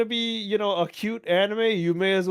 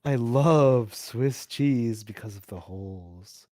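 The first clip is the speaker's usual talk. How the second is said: deep rat voice; 6 semitones lower than usual. Pretend. VTuber rat bit.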